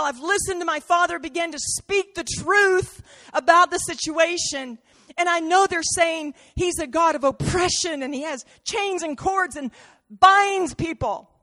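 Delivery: high, urgent voice of a son who can't wait; Jesus pleading high and urgent with the Father; impatient pleading. Acted. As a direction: pour it out, unable to wait any longer.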